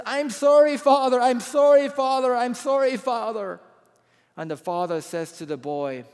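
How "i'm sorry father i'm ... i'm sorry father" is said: loud, high-pitched mock crying plea; retold crying apology. Boy begging his father's forgiveness after seeing the bruised arm, acted crying. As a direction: act out a child's tearful cry, loud and high. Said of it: "he starts crying and".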